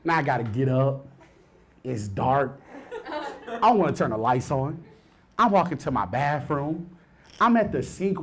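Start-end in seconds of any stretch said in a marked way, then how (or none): none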